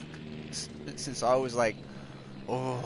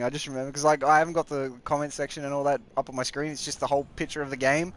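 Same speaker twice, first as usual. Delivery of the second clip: ordinary talk, nothing marked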